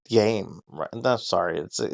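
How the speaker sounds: usual speaking voice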